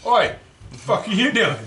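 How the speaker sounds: sharp, loud, high Oi at the driver; mock yell. Son yelling at dad taking the wheel, loud and high. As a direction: call him out, loud and high. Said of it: "I look over and I go".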